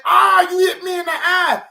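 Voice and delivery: loud, high mock-crying complaint; mocking whine. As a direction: ham up the suspect's whining cry. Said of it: "with all this ooching and ouching".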